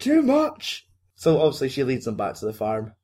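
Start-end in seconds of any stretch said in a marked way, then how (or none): 0.0-0.9 high, hammed-up cry of alarm; mock panic. Podcaster mimicking runner fleeing bikers, high mock panic. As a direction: wail it like it's all too much.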